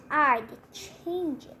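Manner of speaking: snide. Acted. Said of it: "Victoria said".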